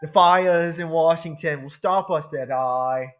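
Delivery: lightly voiced Cuppa, loud and exasperated; Cuppa impression. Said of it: "What's your best Cuppa impression?".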